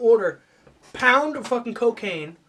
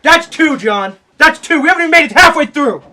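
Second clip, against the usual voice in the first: loud, high telling-off; hammed-up outraged reprimand; exasperated scolding; 5 semitones higher, 14 dB louder than usual. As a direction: yell it as a second warning. Warning the songwriter of strikes.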